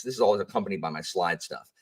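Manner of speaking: usual speaking voice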